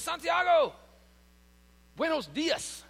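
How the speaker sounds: high, loud, delighted hello; excited greeting. Retold or imitated. Restaurant owner loudly greeting his regular at the door. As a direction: call out the name like an old friend.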